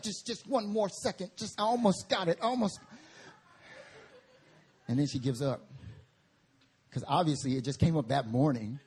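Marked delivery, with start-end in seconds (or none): 0.0-2.8 mom voice. Retold or imitated. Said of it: "She's like".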